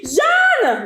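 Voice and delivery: a priest calling after the cyclist, shouted; shouted name. Acted. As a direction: yell the name after her down the street. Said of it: "Le type crie".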